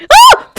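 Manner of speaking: sudden high yelp of shock. Real, not acted. Viewer jolted by the episode, high shocked yelp. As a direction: yelp as the scene jolts you.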